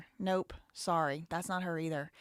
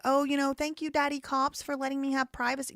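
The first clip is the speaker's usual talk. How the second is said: higher-pitched, sarcastic thanks; mock gratitude; 5 semitones higher, 4 dB louder than usual. Livestreamer mocks grateful citizens thanking the police, sarcastic. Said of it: "It's not like".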